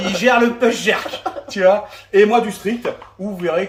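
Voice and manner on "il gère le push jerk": laughing. Real, not acted. Joking about his push jerk.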